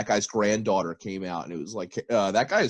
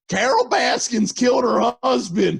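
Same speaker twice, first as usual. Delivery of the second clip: higher, louder laughing mock yell; mock screaming; 13 semitones higher, 7 dB louder than usual. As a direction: shout it like drunks from a passing boat. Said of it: "they're screaming".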